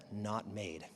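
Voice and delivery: ordinary talk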